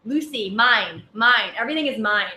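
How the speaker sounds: put-on loud, grabby little-kid voice; toddler niece saying mine. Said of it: "and she's in this, like".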